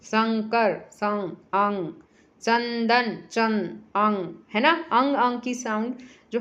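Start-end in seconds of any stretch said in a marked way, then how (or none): 0.9-1.9 सं and अंग slow and separate; slow syllable split. Shown as a demonstration. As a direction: split शंकर slowly into सं and अंग. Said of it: "अंग की sound की आवाज़ आती है".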